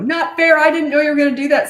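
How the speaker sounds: put-on high, loud, fast whine of an indignant child; mock child wailing it's not fair; whiny kid protest. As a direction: squeal it like a six-year-old caught out. Child protests the surprise consequence.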